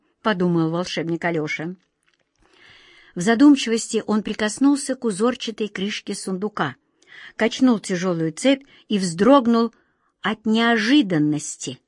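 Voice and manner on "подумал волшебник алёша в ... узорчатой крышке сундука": usual speaking voice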